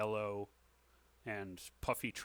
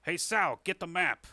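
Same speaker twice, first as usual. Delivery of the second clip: raised, louder call to the bartender; in-character shout for service, higher and louder; 6 semitones higher, 5 dB louder than usual. Acted. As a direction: call an order across the bar. Boss calls to his bartender.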